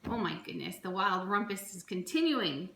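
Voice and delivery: ordinary delivery, nothing marked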